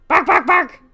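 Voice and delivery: very loud, high barks at the cat; loud barking imitation. Shown as a demonstration. Owner barking at her cat to test it, loud high barks. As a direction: bark at the cat like a dog. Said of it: "Let me see, let me see".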